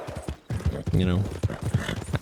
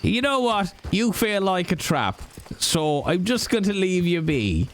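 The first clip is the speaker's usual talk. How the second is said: put-on Irish accent; 6 semitones higher than usual. Irish character taunts horse rider.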